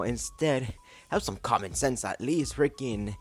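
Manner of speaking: normal talking voice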